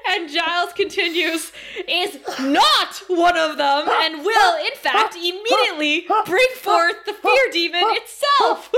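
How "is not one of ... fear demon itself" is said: loud, high reading that breaks toward laughter; emphatic mock reading. Giles finishes reading the spell too late, loud mock-dramatic.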